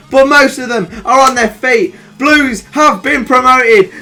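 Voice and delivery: proud, drawn-out celebration; triumphant commentary. Real, not acted. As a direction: call the promotion like a big-match commentator. Team promoted at final whistle.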